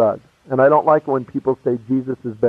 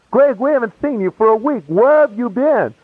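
higher-pitched, insistent imitation of friends; cross-examining; 6 semitones higher than usual. Friends question a pal missing for a week, cross-examining. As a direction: press the question in a raised voice. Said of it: "They kept cross-examining me".

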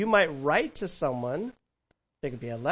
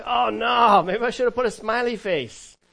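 higher, louder hammed-up oh no; mock panic; 5 semitones higher, 7 dB louder than usual. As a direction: play the panicked texter for laughs. Worried texter voiced in sermon.

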